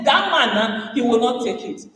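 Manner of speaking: ordinary delivery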